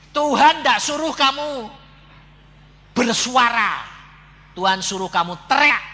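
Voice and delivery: loud, high, deliberate correction; emphatic scolding. Retold or imitated. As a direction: drive each phrase home loudly. Insistently scolding the mute woman.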